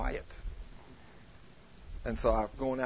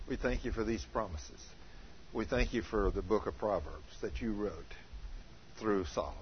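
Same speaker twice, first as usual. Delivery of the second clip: soft, low reverent prayer; 5 semitones lower, 6 dB quieter than usual. Acted. Pastor closing the service, soft reverent prayer.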